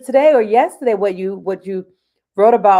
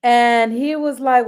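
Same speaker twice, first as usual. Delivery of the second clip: ordinary talk, nothing marked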